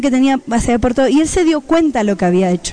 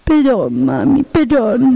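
tearful apology; much slower than usual. Retold or imitated. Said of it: "empieza a caerle las lagrimitas".

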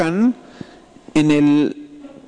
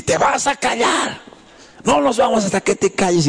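higher-pitched scolding without real volume; mock mother's yell; 7 semitones higher than usual. Mother to crying little girl in public, mock yelling. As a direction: imitate an exasperated mother ordering silence. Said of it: "la mamá gritaba, gritaba".

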